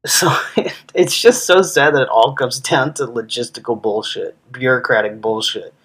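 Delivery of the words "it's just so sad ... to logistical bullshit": ordinary delivery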